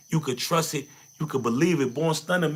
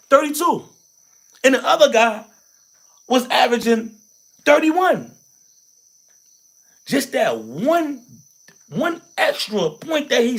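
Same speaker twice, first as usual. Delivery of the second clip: much higher and louder on each number; emphatic numbers; 6 semitones higher, 5 dB louder than usual. Real, not acted. Making the one-point gap land, high loud emphasis. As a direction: punch the figures out. Telling Jordan stats with emphasis.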